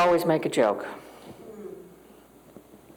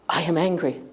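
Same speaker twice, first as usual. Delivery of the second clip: ordinary delivery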